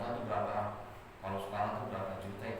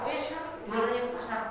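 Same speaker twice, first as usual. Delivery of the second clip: high, eager voice of a kindly stranger; obliging stranger; 13 semitones higher, faster than usual. Acted. Angel in disguise telling Ali to go sell, high and eager. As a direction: chirp it up high, all helpful.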